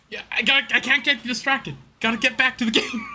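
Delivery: excited. Real, not acted. Excited self-urging.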